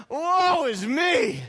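loud, high, slow anguished wail; beating our breast. Shown as a demonstration. Preacher leading the church in a loud, high breast-beating lament. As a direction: cry it out to the heavens. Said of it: "Say that like we're beating our breast".

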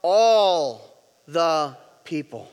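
drawn-out ALL, then spaced words; slow group recitation. Shown as a demonstration. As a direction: lead it very slowly, word by word. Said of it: "Let's go back".

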